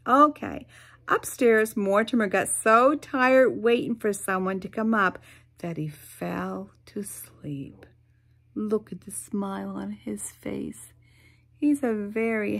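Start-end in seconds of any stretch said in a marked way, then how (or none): 5.6-10.9 whisper. Acted. Boy falls asleep in story.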